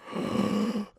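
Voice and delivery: sharp, frightened intake of breath; retold startled gasp. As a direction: gasp in shock. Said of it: "I'm like".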